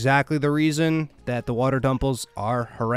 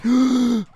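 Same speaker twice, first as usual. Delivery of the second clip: high startled gasp; sharp gasp; 8 semitones higher than usual. Real, not acted. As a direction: gasp in shock at the game. Reacts as Pikmin get flung.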